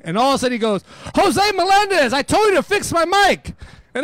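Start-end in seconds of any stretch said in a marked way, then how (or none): 1.1-3.5 mock angry shout, higher and louder; calling out by name. Singer calling out the DJ by name, loud mock yell. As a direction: singer naming him over the mic, hammed-up yell.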